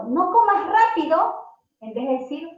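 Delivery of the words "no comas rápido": higher and louder, a sharp command; scolding order. Shown as a demonstration. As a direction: snap at a child gulping food.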